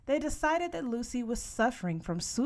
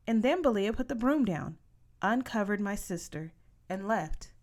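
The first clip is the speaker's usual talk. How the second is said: plain reading voice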